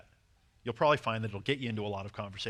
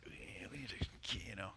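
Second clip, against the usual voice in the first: very soft, slow mumbled reply; 2 semitones lower, 14 dB quieter, slower than usual. Retold or imitated. Host answers friend's demand for talk time, mumbling. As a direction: mumble it evasively under your breath.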